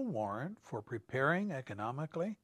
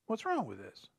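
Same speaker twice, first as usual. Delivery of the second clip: puzzled question; 8 dB quieter than usual. Retold or imitated. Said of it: "We read that and we ask".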